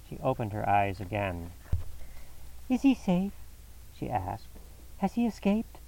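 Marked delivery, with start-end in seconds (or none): 2.7-5.8 much higher, anxious female voice; frightened wife asking after him; anxious woman's voice. A narrator's reading. Mrs Stapleton, freed, anxiously asks about Sir Henry, much higher.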